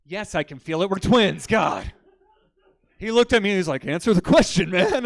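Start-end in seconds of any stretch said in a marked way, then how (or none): 0.0-1.9 mock irritation building to a loud burst; exasperated retort. Twin brother answering a rude question, exasperated. 3.9-5.0 high, loud mock shout; insistent demand. Friend pressing him for an answer, insistent shout.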